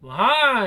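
high, loud drawn-out moan; acted moan. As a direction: let out a loud mock moan. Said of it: "the moans get out of hand".

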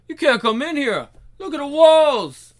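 high, loud protest; alarmed shout. Acted. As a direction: fend off an intruder in panic. Frightened boss repelling intruder.

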